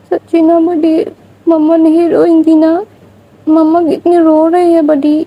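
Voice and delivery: put-on a small child begging her big sister what to do; pleading little girl.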